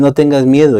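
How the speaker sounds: No tengas miedo called out loud and high; ringing No tengas miedo; proclaimed. Shown as a demonstration. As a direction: announce No tengas miedo like a promise to a crowd.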